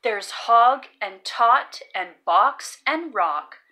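ordinary delivery

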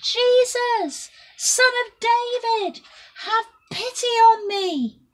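high, loud desperate cry; a blind beggar cries out to Jesus, full-voiced shout; shouting at the top of his voice. A narrator's reading. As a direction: call out over the crowd with all his strength. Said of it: "he shouted at the top of his voice".

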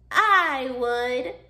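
drawn-out, loud crusty coral reply; creaky coral. A narrator's reading. Crusty coral volunteers to help Hermit Crab, creaking. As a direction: answer as a crusty old coral, stretched out. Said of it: "creaked a crusty coral".

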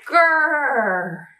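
mock tiger growl, falling; a small creature's brave little growl; playful growl. Mouse insisting it is a tiger, playful mock growl.

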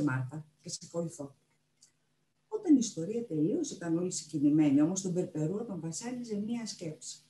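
plain reading voice

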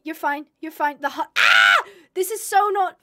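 ordinary delivery

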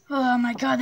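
ordinary talk

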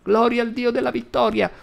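put-on high loud old woman's cry of praise; frenzied granny shout. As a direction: cry it out ecstatic. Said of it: "urlò la nonna".

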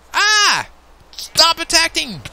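loud, very high mock-panic shout; panicked yell. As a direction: yell at your attacker to back off. Attacked by chicken in game.

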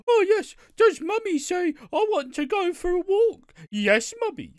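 put-on over-the-top baby voice for a pet; doting dog owner. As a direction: coo at the dog like a doting mummy. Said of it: "talking to a dog like".

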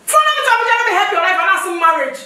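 loud, high furious tirade; furious shouting. Acted. Wife shouts down husband over the marriage threat, furious and loud. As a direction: blast him with scorn and rage.

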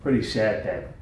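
low, hushed deadpan with pauses; soft, grave scolding, dropping to near whisper; imitated child's lecture. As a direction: quietly lecture dad as his little son. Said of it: "Getting lectured".

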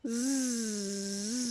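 quiet drawn-out zzz; soft snore. A narrator's reading. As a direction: drift off into a snore. Little one falls asleep. (ronflement doux)

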